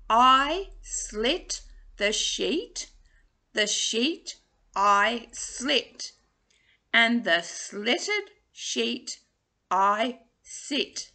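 very slow, each word set apart; slow tongue twister. Shown as a demonstration. Coach modelling the sheet twister slowly. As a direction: lay the words out slowly. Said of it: "say them again for you".

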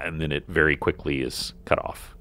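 usual speaking voice

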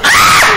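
sudden high-pitched shriek; startled scream. Real, not acted. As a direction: scream at a jump scare. Startled by the game.

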